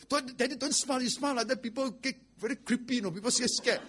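high-pitched mock plea from the kids; high, excitable retold child protest; pleading children. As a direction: imitate alarmed children begging Dad to stop. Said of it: "my children".

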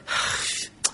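put-on sigh of reluctance; grudging sigh. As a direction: sigh as an unwilling giver. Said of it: "full of grief".

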